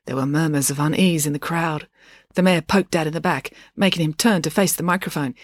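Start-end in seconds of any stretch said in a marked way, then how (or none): none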